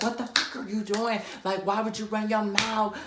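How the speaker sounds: high-pitched, held-back retold rant; going off. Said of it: "Like, just going off".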